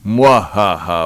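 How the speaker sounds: low, slow villain laugh; sneering panto villain. Pretend. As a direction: cackle like a panto baddie. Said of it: "this is gonna be spooky".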